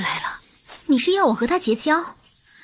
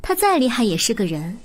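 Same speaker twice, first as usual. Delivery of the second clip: ordinary delivery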